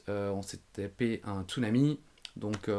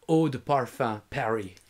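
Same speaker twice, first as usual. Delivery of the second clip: French words in an American accent; mock American pronunciation of French; 4 dB louder, slower than usual. Shown as a demonstration. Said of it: "je prends l'accent américain".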